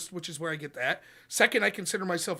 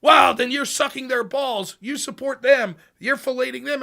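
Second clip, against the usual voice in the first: loud, higher imitation of an accuser; mock outraged accusation; 5 semitones higher, 8 dB louder than usual. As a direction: pile on the accusations, indignant. Mimics smearing accusers.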